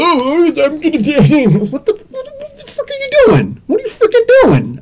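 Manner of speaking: very high, frantic squealed freak-out; hammed-up panic. Acting out the sniper scene, hammed-up panic.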